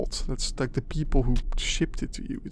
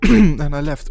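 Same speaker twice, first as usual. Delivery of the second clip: loud repeat; 2 semitones higher, 12 dB louder than usual. Real, not acted.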